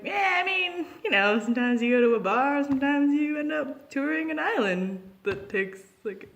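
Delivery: high, breezy mock shrug; shrugging off the friends' shock, mock casual; mock nonchalant. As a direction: play it off as totally normal, tongue in cheek. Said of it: "And we're like".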